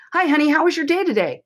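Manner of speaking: dad picking up his daughter from school, upbeat greeting; cheery dad greeting. Retold or imitated. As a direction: lift the voice like a friendly dad.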